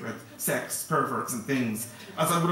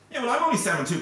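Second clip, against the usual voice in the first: put-on boastful boy; 10 semitones higher, 6 dB louder, faster than usual. Other boy answers the same words.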